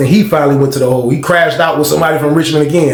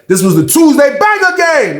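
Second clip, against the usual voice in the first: hype announcement; 11 semitones higher than usual. Real, not acted. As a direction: announce it like a show host. Outro announcement shouted.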